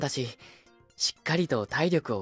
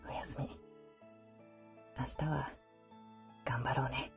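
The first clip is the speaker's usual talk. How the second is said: soft tender voice close by; pillow talk; 6 semitones lower, 3 dB quieter, faster than usual. Acted. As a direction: murmur lovingly to soothe to sleep. Sleeping beside listener, tender.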